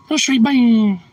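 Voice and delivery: high, loud cooing. Real, not acted. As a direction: gush over the dog. Baby talk to dog.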